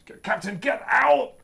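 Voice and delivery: high, strained, gasping crewman; thin reedy voice. Acted. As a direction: cry it out exhausted and breathless, lungs pumping. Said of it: "very reedy voice, ... exhausted, like, lungs pumping".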